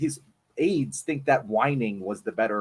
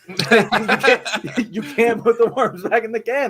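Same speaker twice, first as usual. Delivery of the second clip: laughing through a mangled saying, pitched up; 7 semitones higher, slower than usual. Real, not acted. Podcaster laughing at his own botched idiom to cohosts. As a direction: chuckle the words out at your own slip.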